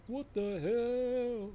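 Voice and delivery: drawn-out high confused question; baffled. Real, not acted. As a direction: stretch it out, puzzled and high. Confused where they are.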